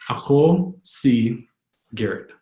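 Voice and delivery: Ako, si, Garrett, set apart; slow, word by word. Shown as a demonstration. Teacher modelling an introduction in Tagalog, slowly word by word. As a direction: pause between words for learners.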